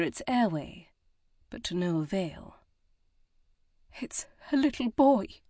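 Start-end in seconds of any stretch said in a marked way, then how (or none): 4.0-5.4 sniffling. A narrator's reading. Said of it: "Lucy sniffled".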